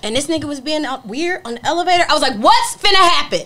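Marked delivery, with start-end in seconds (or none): 2.4-3.4 confrontational challenge. Retold or imitated. Confronting man in elevator.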